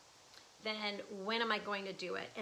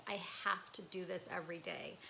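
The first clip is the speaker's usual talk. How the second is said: urgent inner whisper; 3 semitones lower, 5 dB quieter, faster than usual. Retold or imitated. Her inner voice urging her to paint, whispered. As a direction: breathe it like a secret calling. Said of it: "that was".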